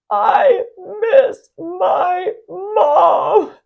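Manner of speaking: loud, high, drawn-out crying shout; a long sobbing scream for his mother; anguished scream. Retold or imitated. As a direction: wail it out like a heartbroken child. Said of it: "screamed out".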